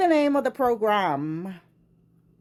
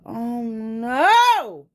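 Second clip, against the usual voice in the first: drawn-out loud oh no; dismayed oh no; 5 dB louder than usual. Real, not acted. Hearing the quote about his brother, a dismayed oh no. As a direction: wail it in dismay.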